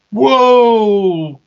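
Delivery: high, loud, stretched "whoa"; acted shock, drawn out; alarmed whoa. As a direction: let it burst out high and long. Shock at sudden deadline.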